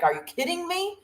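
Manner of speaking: high-pitched incredulous protest, acted; mock disbelief. Speaker voices the right to complain, mock disbelief.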